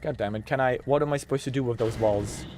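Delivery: usual speaking voice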